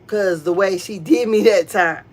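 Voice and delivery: ordinary talk, nothing marked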